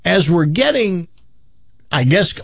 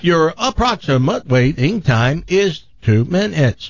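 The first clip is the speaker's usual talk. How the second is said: choppy automated hold-line voice; robotic. Retold or imitated. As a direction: chop the syllables like a machine. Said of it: "it's a robotic thing".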